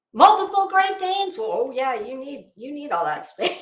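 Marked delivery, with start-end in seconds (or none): 0.1-1.4 amazed. Real, not acted. Reacting to viewer's dogs.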